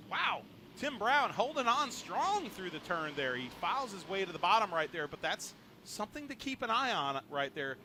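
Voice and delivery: excited play-by-play. Real, not acted. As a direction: call the green flag with energy. Live race call on restart.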